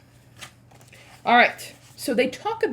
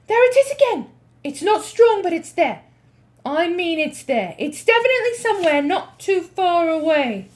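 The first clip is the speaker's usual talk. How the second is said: excited witch; 8 semitones higher, 7 dB louder than usual. Acted. Witch insists she smells it.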